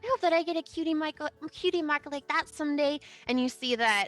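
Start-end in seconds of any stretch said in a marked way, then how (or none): 0.0-3.0 high, small filly voice; little voice. Acted. Tiny filly offering hors d'oeuvres to a guest, high and small. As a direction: play a tiny shy foal offering food.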